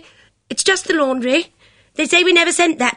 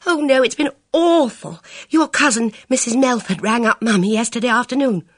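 high, anxious rush of words; fretful girl's voice; agitated; 9 semitones higher, 5 dB louder than usual. Acted. Bridget pours out her worry.

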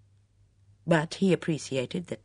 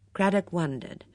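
ordinary talk, nothing marked

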